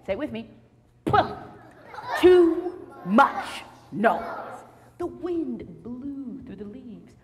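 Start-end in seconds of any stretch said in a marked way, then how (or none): 1.0-4.2 loud, very slow grumpy complaint; grumpy old man. Acted. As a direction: drag it out crankily. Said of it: "And he said".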